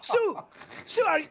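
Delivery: high, sputtering retold disbelief; flabbergasted. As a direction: re-enact being lost for words.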